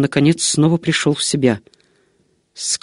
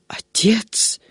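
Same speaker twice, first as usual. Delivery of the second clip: a stunned breathless whisper of one word; despairing whisper; 5 semitones higher, 4 dB quieter than usual. A narrator's reading. Monk whispers Отец, seeing the medallion portrait, in despair. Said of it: "в отчаянии воскликнул монах".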